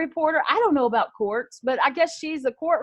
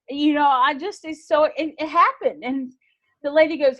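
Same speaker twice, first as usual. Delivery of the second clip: ordinary talk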